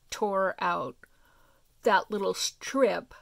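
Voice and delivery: words stretched by a yawn. Real, not acted. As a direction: talk through a yawn. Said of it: "Sorry, I'm yawning".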